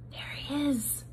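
hushed whisper; mice whispering. A narrator's reading. Mice gossip about Despero, whispered. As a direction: breathe it out in a hush. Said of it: "the mice whispered".

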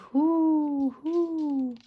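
high hooting wind. Acted. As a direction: hoo like the wind. Wind sound in story.

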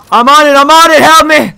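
high, loud call for help; urgent yelling. Real, not acted. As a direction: yell out under pressure. Urgent calling out in game.

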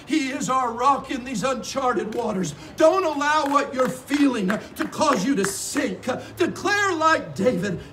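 loud, driving exhortation; forceful preaching. Real, not acted.

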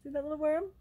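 ordinary delivery, nothing marked

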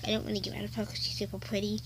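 soft fast whisper. Real, not acted. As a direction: breathe it under your breath. Whispered aside about doll.

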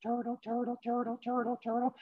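small, high, soft chant; quiet high-pitched patter, evenly repeated; turtle trudging along. Acted. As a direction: chug along softly as a tiny cartoon turtle. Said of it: "keeps on doing his turtle thing".

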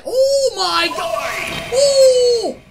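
loud, high, drawn-out real shout; startled shout. Real, not acted. Player surprised by the pirate's appearance, startled shout. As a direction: yell out in sudden amazement.

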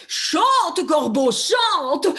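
lightly voiced fox, loud and slow; sly fox. Fox urging the crow to sing, loud slow coaxing. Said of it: "le renard dit au corbeau".

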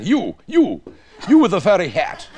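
loud, high mock-officer call; bossy redcoat. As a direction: hail a stranger with comic imperious force. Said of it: "it was all the red tunics".

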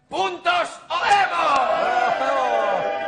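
long drawn-out shouted chant; slogan shout. Shown as a demonstration. Repeating the team slogan on request, a long drawn-out shout. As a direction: bellow the slogan, stretched out. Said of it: "voy a repetirlo".

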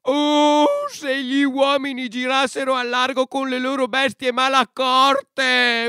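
high, loud whining insect voice; complaining ant king. A narrator's reading. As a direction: grumble indignantly as a tiny ant king. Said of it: "un re delle formiche che si lamentava".

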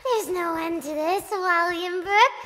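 low menacing ghost. Acted. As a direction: menace them quietly. Ghost threat.

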